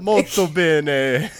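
slow, drawn-out Italian phrase; mock Italian. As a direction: ham it up like a chef.

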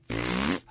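child's mocking raspberry; tongue out, blow a long rude raspberry; blown raspberry. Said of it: "stuck his tongue out and said".

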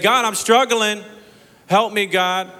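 loud, high, slow desperate cry; shouting at God. Pretend. A believer shouts to God for help, loud and desperate. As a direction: cry out for help at full force. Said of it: "you can shout at God and say".

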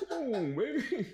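high pleading voice through laughs; mock whiny partner. Partner texting about the food, high and whiny. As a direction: whine it like a nagging partner. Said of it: "they gonna text".

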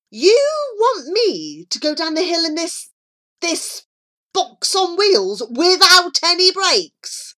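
high-pitched incredulous question; rising, appalled boyish objection; alarmed protest. A narrator's reading. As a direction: protest in disbelief, stressing the danger. Charlie protests about the brakeless kart.

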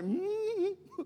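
high squeaky nervous mm-hmm. Retold or imitated. Teen answering the driving tester, high and nervous. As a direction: squeak out a scared yes. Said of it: "And I'm like".

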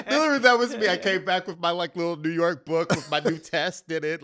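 high, loud, laughing self-mockery; giddy boasting. Real, not acted. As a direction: gush about your new book, giggling.

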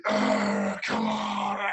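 slow, high mock snarl into a shout; animal growl. Brother mocking the player walking onto the pitch, mock snarl. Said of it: "you're like".

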